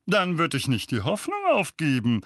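high, loud spiteful jeer; spiteful uncle taunting Harry. A narrator's reading. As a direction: twist the knife. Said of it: "sagte Onkel Vernon gehässig".

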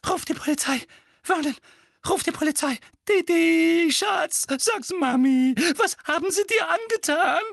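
high, loud hysterical mother's shriek; frantic high-pitched screeching. Acted. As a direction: shriek in panic over your darling boy. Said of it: "Tante Petunia kreischte".

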